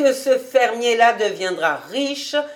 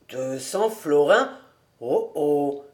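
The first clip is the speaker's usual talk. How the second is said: slow, lower questioning with mild mockery; half-serious, half-mocking; 4 semitones lower, slower than usual. A narrator's reading. Cobus hears the loan request, half serious, half mocking. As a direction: query the sum, gently teasing. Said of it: "d'un air moitié sérieux et moitié railleur".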